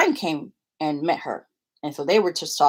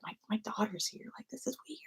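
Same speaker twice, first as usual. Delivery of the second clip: very soft, raised, hushed voice; awkward and shy; 5 semitones higher, 15 dB quieter, faster than usual. Retold or imitated. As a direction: imitate a shy dad under his breath. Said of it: "felt so awkward, like, and shy".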